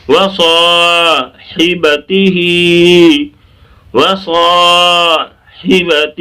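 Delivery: slow chant of وصاحبتہ with long saa; stretched recitation. Shown as a demonstration. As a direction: hold saa and final hee in وصاحبتہ, hi short. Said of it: "سا کہہ کے رک جائیں".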